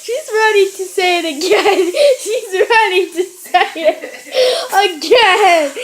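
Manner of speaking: cracking up while trying to speak; real giggles breaking up the words; laughing. Real, not acted. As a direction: talk through giggles. Cracking up at mom's prank.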